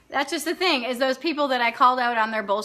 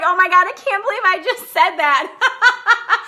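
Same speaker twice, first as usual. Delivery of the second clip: slow, loud, laughing through it; laughing disbelief; 6 semitones higher, 6 dB louder than usual. Retold or imitated. She laughs at what she just said to the waiter. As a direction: giggle at your own boldness. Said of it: "And I was like".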